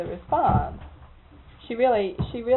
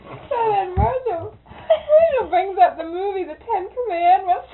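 laughing; 10 semitones higher, 5 dB louder, slower than usual. Real, not acted. Teller laughing, breaking.

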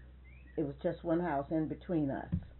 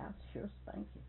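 whispered playful threat; 3 semitones higher, 13 dB quieter than usual. Retold or imitated. Teasing threat to grandson.

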